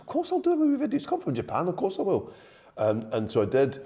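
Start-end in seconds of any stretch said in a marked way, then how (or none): 0.0-2.3 higher-pitched, keen yes; eager agreement. Retold or imitated. Comedian retelling his reply to a friend's favour, eager agreement. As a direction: retell agreeing at once, voice jumping up.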